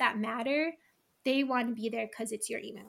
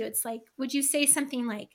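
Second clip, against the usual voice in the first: usual speaking voice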